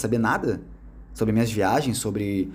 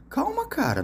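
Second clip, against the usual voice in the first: sharp high jump on "Calma", then falling; half-laughing, pleading "calm down, man"; high squeak of disbelief; exasperated calm down; 3 dB quieter than usual. Retold or imitated. Answering the skeptic, exasperated.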